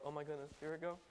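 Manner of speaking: low, hushed "bracing yourself" mutter; very soft acted jitters; nervous anticipation. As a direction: mutter it privately as dread builds. Nervous thought while waiting in line.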